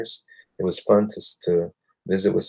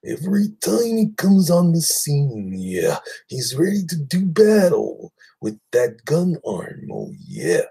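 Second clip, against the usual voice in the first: much higher, slow, louder wrestling-promo voice; over-the-top wrestler promo; Macho Man impression; 8 semitones higher, 4 dB louder than usual. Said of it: "He talks like the Macho Man".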